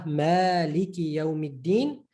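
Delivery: "maliki" stretched slowly with a long ma; slow drawn recital. Shown as a demonstration. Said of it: "Like in Surah Fatiha".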